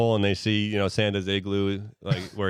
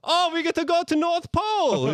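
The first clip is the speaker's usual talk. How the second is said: put-on excited kid. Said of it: "Stan's like".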